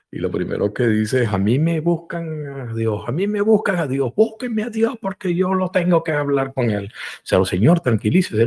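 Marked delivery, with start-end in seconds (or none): 1.3-6.9 mild mock fury. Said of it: "y llega furioso".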